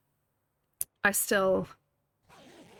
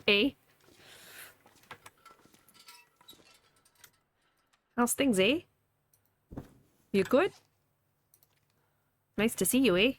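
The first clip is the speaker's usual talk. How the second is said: put-on Canadian accent; 4 semitones higher than usual. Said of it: "a Canadian".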